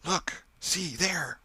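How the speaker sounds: high, urgent hushed cry; frightened whisper. A narrator's reading. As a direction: point it out in terror. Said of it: "whispered Tom".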